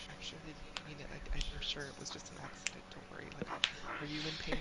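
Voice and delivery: soft, tender comfort to a tree; soft reassurance. Acted. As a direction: soothe a hurt tree gently. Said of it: "and be like".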